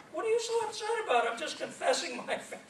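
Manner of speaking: squeaky protest to his grumpy friend Bob; mock indignant. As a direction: play it like you can't believe he's upset. Retold argument with friend Bob.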